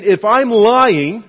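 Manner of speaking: loud, slow and high, every word weighed; a desperate man swearing his innocence; emphatic oath. Retold or imitated. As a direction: swear it with all your force. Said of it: "that is about as emphatic as you can possibly get".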